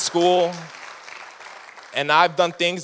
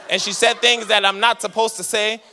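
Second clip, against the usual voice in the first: ordinary talk, nothing marked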